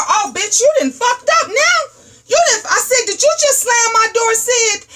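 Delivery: mom blowing up, shrieking high; mock furious. Mom yelling at daughter for slamming the door, high and furious.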